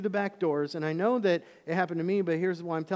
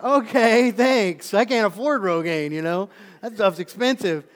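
exasperated reply; 5 semitones higher, 11 dB louder, slower than usual. Retold or imitated. Retelling his reply.

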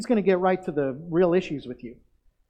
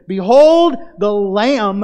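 slow, much louder proclamation; proclaiming loudly; 2 semitones higher, 10 dB louder, much slower than usual. Acted. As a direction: announce it slowly, each word ringing. John the Baptist proclaiming the Lamb.

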